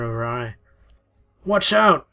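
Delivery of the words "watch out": loud, high warning shout. Acted. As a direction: yell to stop her in time. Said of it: "someone shouted".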